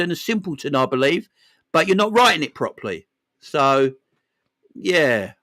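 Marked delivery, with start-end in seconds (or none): none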